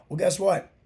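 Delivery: usual speaking voice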